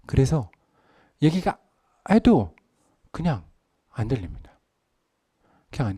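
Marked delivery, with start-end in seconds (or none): none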